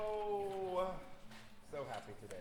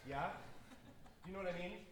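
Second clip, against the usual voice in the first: ordinary talk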